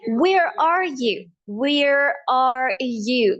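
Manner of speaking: very slow, drawn-out American R; American accent. Shown as a demonstration. Teacher repeats for learners, slow American accent. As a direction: spell out each word slowly, American accent. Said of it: "American accent में मैं बोलूंगी".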